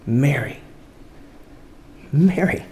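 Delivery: soft, quiet calling of her name; tender call. Acted. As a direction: say her name gently, like waking her. Jesus softly calls her name.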